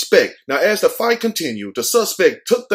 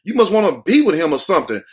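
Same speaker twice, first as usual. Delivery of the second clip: mock telling off; 7 semitones higher than usual. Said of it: "I would have been like".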